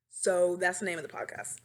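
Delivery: ordinary delivery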